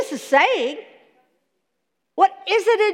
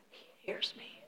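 unvoiced, much softer echo; whisper; 25 dB quieter than usual. Preacher echoes the psalm in a hushed whisper. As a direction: let it fade to a whisper.

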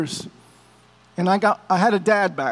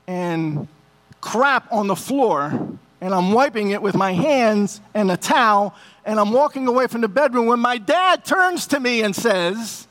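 usual speaking voice

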